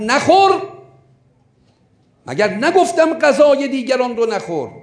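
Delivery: high, loud scolding yell; sudden shout. Retold or imitated. Old man shouting at the pilgrim to stop eating. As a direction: bark it out as the old man stopping him. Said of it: "یک دفعه فریاد زد اون پیرمرد".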